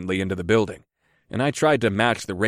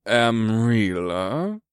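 oily voice; much slower than usual. A narrator's reading. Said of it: "repeated in an oily voice".

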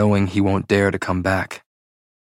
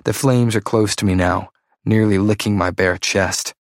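plain reading voice

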